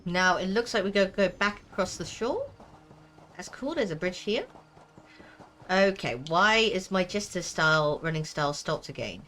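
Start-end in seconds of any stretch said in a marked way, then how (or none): none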